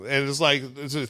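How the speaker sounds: ordinary delivery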